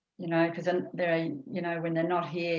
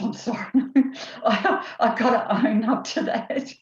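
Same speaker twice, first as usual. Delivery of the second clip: higher, louder, laughing confession; real embarrassed laughter, slowed down; sheepish laugh; 7 semitones higher, 6 dB louder than usual. Real, not acted. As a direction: giggle through a guilty admission. Confessing to cappuccinos.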